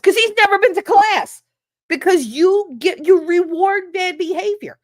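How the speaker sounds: loud, high, fed-up rant; exasperated outburst. Real, not acted. Venting about rewarded bad behavior, loud and high. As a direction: burst out in real exasperation. Ranting about failing kids.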